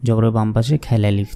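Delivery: ordinary talk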